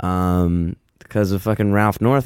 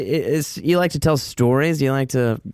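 ordinary delivery

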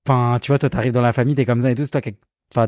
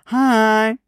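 high mock English accent greeting; 10 semitones higher, much slower than usual. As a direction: say hi like a Brit. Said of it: "et je fais".

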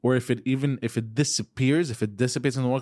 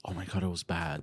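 hushed aunt; 8 semitones lower, 11 dB quieter than usual. Retold or imitated. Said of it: "she'll always go".

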